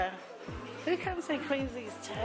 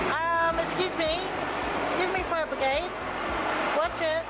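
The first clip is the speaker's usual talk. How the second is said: mock officious call; 8 semitones higher than usual. Joking pretend fire brigade call.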